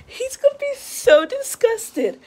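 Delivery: high, drawn-out excited squeal of words; giddy, high-pitched and slow; gleeful anticipation. Real, not acted. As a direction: stretch it out high, relishing the prank. Gleefully anticipating the prank victim's disgust.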